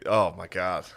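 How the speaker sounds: usual speaking voice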